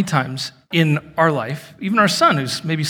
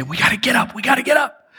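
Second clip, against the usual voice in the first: raised, repeated mock shout; acted-out urgent call, climbing higher; retold urgent alarm; 7 semitones higher, faster than usual. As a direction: urge her up in a panic. Acting out urgent sleepwalking alarm.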